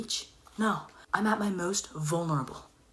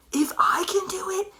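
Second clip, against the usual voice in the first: squeaky whisper; 12 semitones higher, 4 dB louder than usual. Shown as a demonstration. Excited realisation, cracked high whisper.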